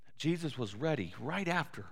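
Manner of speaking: normal talking voice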